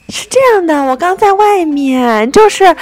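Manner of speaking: high, slow, meek excuse; very polite apologizer. Retold or imitated. Delivery man explaining himself on arrival, very polite. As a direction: imitate a man suddenly grovelling politely. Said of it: "特别礼貌".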